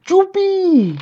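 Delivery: high-pitched cry of joy; joyful shout. A narrator's reading. Boy on the chair shouting for joy, high yelp. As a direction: shriek it with delight. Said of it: "me puse a gritar de la alegría".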